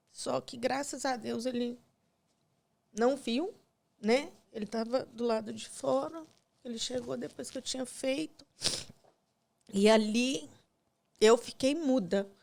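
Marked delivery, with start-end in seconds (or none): none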